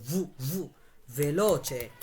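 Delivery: ভু isolated, then ভেলোচে with the same V; V sound drill. Shown as a demonstration. Teacher drills students, ভু then ভেলোচে with V. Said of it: "ঠিক এই ভু এর মতো উচ্চারণ হবে".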